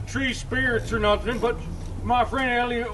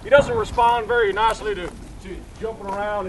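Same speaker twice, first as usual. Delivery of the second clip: ordinary talk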